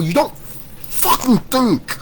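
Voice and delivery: high slow contempt; angry rant. Real, not acted. First take to doubters, slow scornful high voice. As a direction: stretch it out, scornful.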